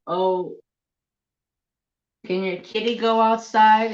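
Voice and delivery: sweet coroner lady. Retold or imitated. Coroner lady asking about the kitty, in a high cooing voice. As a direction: ask like a charmed stranger cooing over a pet. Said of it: "the coroner lady says".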